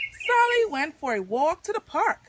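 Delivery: ordinary delivery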